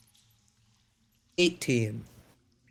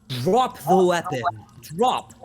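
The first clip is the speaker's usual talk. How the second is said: raised, louder commanding voice; firm command; 5 semitones higher, 5 dB louder, slower than usual. Acted. Caster ordering a desperate warrior to disarm, loud command. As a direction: order it high and loud, word by word. Player casts a command on him.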